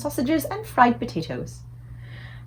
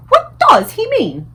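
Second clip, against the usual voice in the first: much higher, slow, louder regal query; a queen baffled, asking slowly; puzzled Queen; 13 semitones higher, 9 dB louder, slower than usual. A narrator's reading. The Queen asking Sophie about the giant, high and slow.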